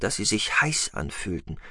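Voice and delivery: ordinary delivery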